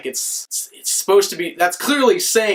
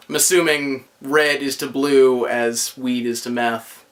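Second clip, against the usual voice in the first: ordinary talk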